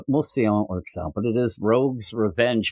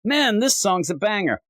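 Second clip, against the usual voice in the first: lightly acted, higher-pitched fan comment; enthusiastic commenter; 8 semitones higher than usual. Commenter praising a song, mildly excited. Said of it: "So people be like".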